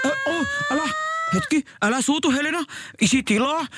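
panicked pleading. Acted. Father panicking to calm his screaming daughter.

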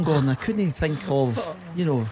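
ordinary talk

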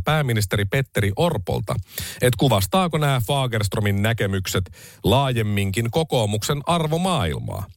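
ordinary delivery

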